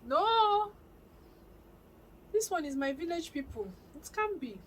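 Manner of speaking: hammed-up wail of disbelief; dramatic disbelief. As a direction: re-enact refusing to believe it. Retold disbelief at bad network.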